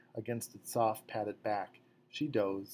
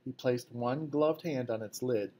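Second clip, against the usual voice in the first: plain reading voice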